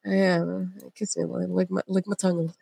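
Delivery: soft, low mumble; doddering old-man imitation. Imagining an old man's lapse of judgment, absently muttered. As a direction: mumble it absently, barely voiced.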